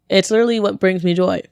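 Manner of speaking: ordinary talk, nothing marked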